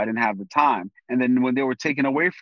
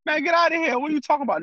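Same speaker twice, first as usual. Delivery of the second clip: high, scoffing dismissal from a buddy; mocking friend; 12 semitones higher, faster than usual. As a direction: brush it off like a teasing friend, pitched up high. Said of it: "And they laugh".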